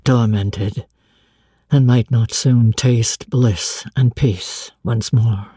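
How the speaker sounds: a blind master confiding his anguish; a hint of a grave older man; Rochester's voice. Rochester recounting to Jane.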